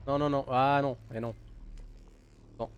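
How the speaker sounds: normal talking voice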